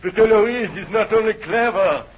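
1 delirious. Acted. Delirious man praises Eloise, high then fading. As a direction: rave high, then let it fall away. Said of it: "getting delirious again".

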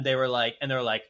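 ordinary delivery

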